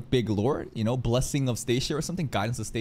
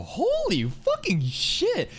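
shocked; much slower than usual. Real, not acted. Shocked at demonic look.

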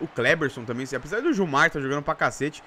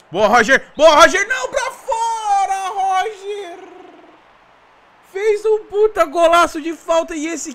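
high loud cry of disbelief; frustrated shout; 11 semitones higher, 7 dB louder than usual. Real, not acted. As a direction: yell as the shot goes wide. Chance perdida, grita. (grito de frustração)

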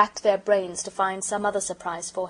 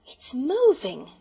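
slow, higher amazed exclamation; girl crying out in amazement; excited cry; 6 semitones higher, 3 dB louder, much slower than usual. A narrator's reading. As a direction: draw out the wonder. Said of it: "cried Bobby".

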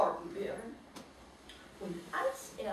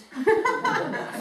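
bright burst of laughter, higher and louder; real laugh; 14 semitones higher, 9 dB louder than usual. Real, not acted. Narrator laughing at the word cool, real laugh. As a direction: break into laughing.

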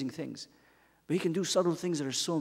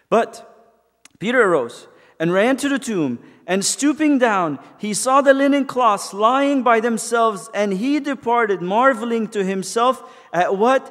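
scripture reading; 7 semitones higher, 8 dB louder than usual. Acted. Reads scripture aloud.

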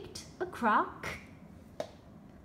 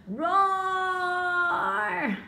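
higher, louder hammed-up roar; tiger roar; 7 semitones higher, 5 dB louder than usual. A narrator's reading. The startled tiger roaring at Izzy, hammed-up. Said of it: "roared the tiger".

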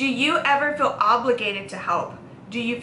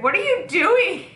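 higher, baffled what-are-you-doing; startled friend; 9 semitones higher, 3 dB louder than usual. Retold or imitated. Quoting Lindsay on the ring alarm, startled and higher.

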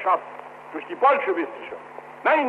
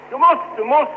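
raised, pleading call; urgent shout; 6 semitones higher, 5 dB louder than usual. Retold or imitated. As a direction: press him hard, loudly. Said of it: "Fünfzig Menschen schrien zugleich".

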